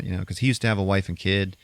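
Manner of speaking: ordinary delivery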